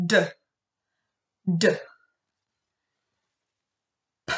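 crisp isolated phonics sounds, each pure and clipped; speech therapist. Shown as a demonstration. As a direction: say each letter sound short and pure, no added vowel. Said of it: "I'll say the sound and then you repeat".

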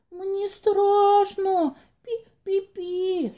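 high, slow frightened squeak; scared mouse. Acted. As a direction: tremble like a tiny mouse. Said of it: "заволновался мышонок".

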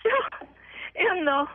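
high, tearful catch in the voice; falter, real tears rising between words; choked up. Real, not acted. Mother recalling learning the long-sought birthdate, choked up.

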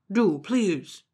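a short urgent plea; pleading dog. Acted. Dog begging Momotaro, urgent plea.

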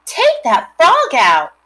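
slow, loud, high bossy command; girl orders her brother, slow and loud; ordered. A narrator's reading. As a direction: lay down the law to her brother. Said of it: "ordered Fern".

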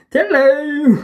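high, loud call that cracks; voice breaking. Real, not acted. Vlogger calling her cat, voice cracking on a loud high call. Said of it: "my voice broke then".

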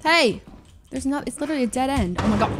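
ordinary delivery

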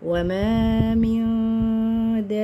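slow, drawn-out tajweed chant; Quran recitation. Shown as a demonstration. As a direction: recite with long held vowels and nasals.

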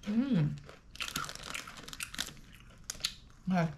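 talking while eating; chewing. Real, not acted. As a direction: mouth partly full. Seen 3: Puts chip in mouth at -4; lips closed, chewing through words.

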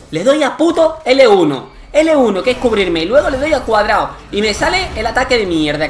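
venting fury at the controls; frustrated rant. Real, not acted. Furious at game controls. (explicando furioso, más alto y más agudo)

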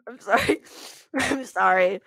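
giggly, helpless apology; can't stop laughing. Real, not acted. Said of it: "when I start laughing".

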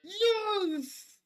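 high, loud exultant yell; triumphant cheer. Pretend. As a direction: cry out in victory. Cheering the court outcome.

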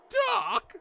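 very high youngster's yelp; alarmed cry. Acted. Boy alarmed at driver.

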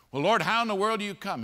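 high, fast, loud; pleading shout, quick; loud exasperated plea. Pretend. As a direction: demand an answer from God. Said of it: "what we're doing is saying".

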